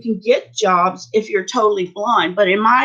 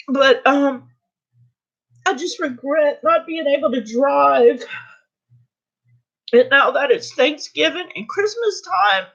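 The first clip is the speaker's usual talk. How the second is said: high tearful regret; real crying; 6 semitones higher than usual. Real, not acted. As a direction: grieve lost independence through tears. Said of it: "you should not cry like I am".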